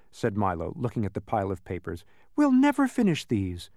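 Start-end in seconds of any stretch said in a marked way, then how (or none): 2.4-3.7 high, falling wail of dismay; lightly acted despair at a hopeless task. Milo facing the pile of papers, dismayed.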